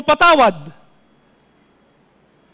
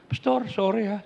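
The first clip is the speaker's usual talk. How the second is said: much softer, lower apology; 8 semitones lower, 11 dB quieter than usual. Retold or imitated. Church member apologizing to the pastor, soft and meek. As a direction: say sorry meekly.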